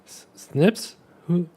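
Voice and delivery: high, loud frightened questions; panicked stammer. Acted. Riolus caught with a knife at his neck, high and panicked.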